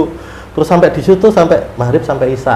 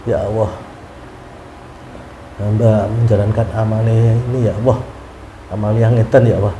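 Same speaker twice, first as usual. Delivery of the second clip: softer, lower, pleading under the breath; hushed tearful prayer; 7 semitones lower, 5 dB quieter than usual. Retold or imitated. Pilgrim praying to God on the road, hushed and tearful. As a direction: pray quietly as if near tears. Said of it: "saya sempat menangis waktu itu".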